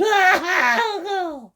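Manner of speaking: very high wailing shriek; shrill hag shriek. Acted. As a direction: wail out as she faints. Mrs Twit voice.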